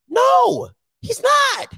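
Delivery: sharp rebuttal. Real, not acted. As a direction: cut him off with a high, hard denial. Heated debate rebuttal.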